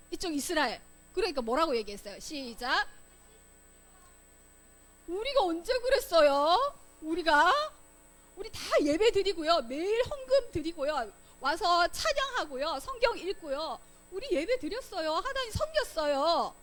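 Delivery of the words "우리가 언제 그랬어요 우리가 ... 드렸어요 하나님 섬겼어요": acted indignant denial, pitched higher; defensive protest. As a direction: protest innocence, listing each good deed. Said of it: "이렇게 얘기를 하는 거예요".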